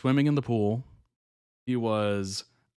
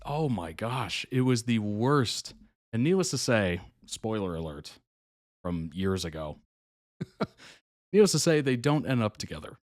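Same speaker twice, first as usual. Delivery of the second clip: ordinary talk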